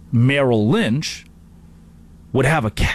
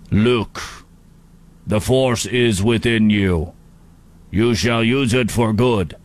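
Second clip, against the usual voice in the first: slow, drawn-out Russian-tinged mentor; solemn hologram advice, slightly Russian; Russian-sounding Obi-Wan; slower than usual. Acted. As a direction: play a ghostly Jedi with an accidental Russian accent. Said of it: "I don't know why he's Russian".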